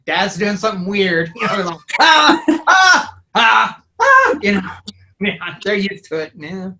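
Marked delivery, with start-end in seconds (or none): 0.1-1.3 imitated son. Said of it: "hear my son in the other room saying". 2.0-4.4 repeated loud, high screams; retold practice screams, one after another; scream takes. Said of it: "I did a couple".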